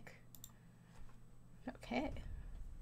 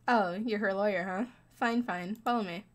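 ordinary talk

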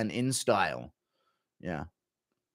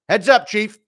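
loud, high call-out; shouting a warning at a kid; heads up call; 8 semitones higher, 9 dB louder than usual. Acted. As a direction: warn him the ball is coming. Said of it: "Impression of Chandler at the adoption agency".

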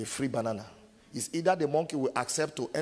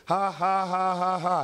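higher, louder staged ha ha; mock laugh; 4 semitones higher, 4 dB louder than usual. Preacher mocking the dream of becoming mayor, fake laughter.

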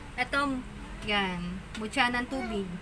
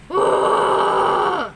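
excited gasp; 4 semitones higher, 10 dB louder than usual. Real, not acted. Light shines through stone.

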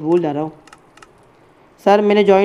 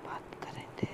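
whisper; 28 dB quieter, faster than usual. Real, not acted. Whispered aside.

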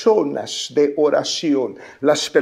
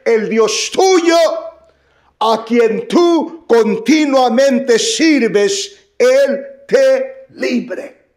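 higher, slow, solemn king blessing Daniel; king's blessing; 7 semitones higher, 5 dB louder, much slower than usual. Acted. Preacher voicing the king to Daniel, slow and solemn. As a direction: draw out the blessing like a hopeful king.

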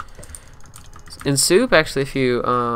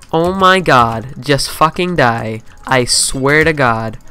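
louder, slow, seething demand; exasperated anger; 8 dB louder, slower than usual. Real, not acted. Gamer losing patience with opponent, slow exasperated anger. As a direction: drag every word out in frustration.

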